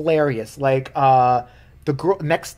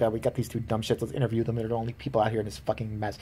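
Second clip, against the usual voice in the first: lower, quieter sneering reporter aside; mock snide reporter; 5 semitones lower, 8 dB quieter than usual. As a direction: grumble it like a reporter muttering off mic. Imitating snarky news reporter.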